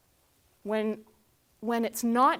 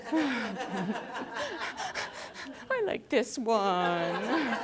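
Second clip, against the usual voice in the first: laughing mock teen; much slower than usual.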